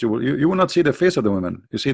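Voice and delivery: usual speaking voice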